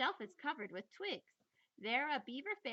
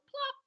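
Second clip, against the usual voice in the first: high, louder plop like a splash; playful plop; 6 dB louder than usual. Acted. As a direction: pop the word out high like a tiny splash. Kit dives into pool.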